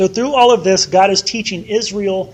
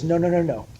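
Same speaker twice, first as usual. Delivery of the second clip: lower, softer, fast and teasing; lightly mock God; 4 semitones lower, 6 dB quieter, faster than usual. God teasing Pharaoh in a low, soft, fast aside. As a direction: shrug it off with a wry buddy. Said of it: "And God goes".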